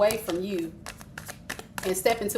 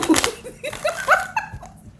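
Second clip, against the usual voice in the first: a very high, rising cackle. Real, not acted. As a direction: burst into a shrieking laugh.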